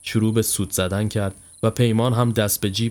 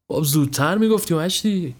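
higher-pitched mild grumble; grumbling; 6 semitones higher than usual. Acted. Friend hurried off the train at short notice, grumbling. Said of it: "غرغر کنان گفت".

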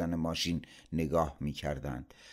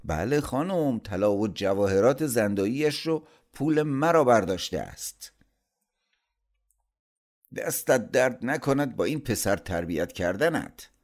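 raised, loud indignant accusation; angry blame; 6 semitones higher, 5 dB louder than usual. A narrator's reading. Uncle blames sister for her thieving son, angry. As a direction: lay on the sarcastic reproach, pitched up.

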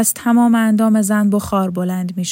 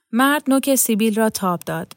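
plain reading voice

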